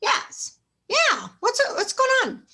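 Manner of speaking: mock eager yes from a curious customer. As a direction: play the friend dying to know. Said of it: "what's the first thing they're gonna say?".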